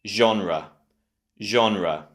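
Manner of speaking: genre as ZHON-ruh; genre falling to an unstressed "ruh"; correct option. Shown as a demonstration. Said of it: "The correct option is A".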